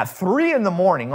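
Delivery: usual speaking voice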